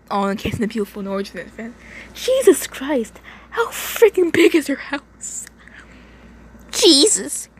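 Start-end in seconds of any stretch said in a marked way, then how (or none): 2.1-7.5 high-pitched, drawn-out disbelief; stunned, slow reader's aside; astonished. Real, not acted. Reader reacting to the huge house.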